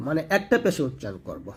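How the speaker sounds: ordinary talk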